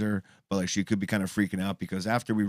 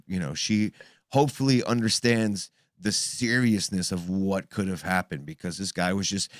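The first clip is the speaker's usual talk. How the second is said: usual speaking voice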